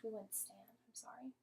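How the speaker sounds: ordinary talk, nothing marked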